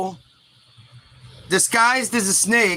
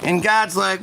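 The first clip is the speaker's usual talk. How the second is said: ordinary talk, nothing marked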